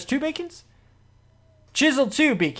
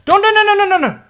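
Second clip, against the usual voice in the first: very high, loud screaming no; panicked scream; 12 semitones higher, 7 dB louder than usual. Real, not acted. Gamer screaming as a mob nearly kills him, panicked. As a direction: shriek the nos in terror. About to die in game.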